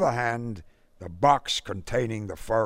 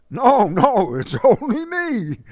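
high, louder nervous joke; feeble joke; 14 semitones higher, 9 dB louder than usual. Acted. Buckhammer feebly jokes to the raging father. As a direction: try to laugh it off weakly. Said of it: "joked Buckhammer feebly".

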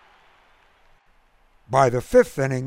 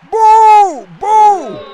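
much higher, loud jeering boo; crowd booing; 15 dB louder than usual. A narrator's reading. The crowd boos the umpire, loud and jeering. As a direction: jeer like a whole angry stand. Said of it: "retorted the crowd".

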